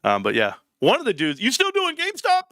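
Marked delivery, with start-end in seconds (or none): 1.4-2.4 very high, incredulous question; mock a nosy dude, voice pitched way up; exaggerated high-pitched asking; imitating a questioner.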